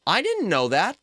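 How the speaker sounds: ordinary delivery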